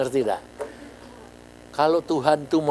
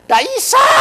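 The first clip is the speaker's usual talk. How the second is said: high, loud cry of defeat; retold yelp of can't do it; exasperated shout; 7 dB louder than usual. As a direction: shout it out, helpless. Retelling failing to read.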